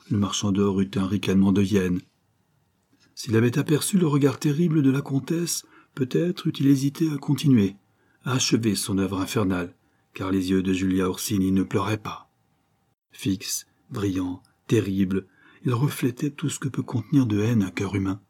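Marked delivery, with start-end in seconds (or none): none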